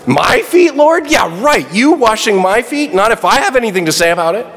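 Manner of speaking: high, rushed incredulous outburst; blurting Peter. Retold or imitated. Peter refusing Jesus washing his feet, blurted. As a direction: blurt it out in disbelief. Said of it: "blurts out the first thing".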